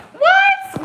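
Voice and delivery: sudden high sharp what; jolted into a shocked what; startled what. Real, not acted. Reacting to her own words, shocked high what.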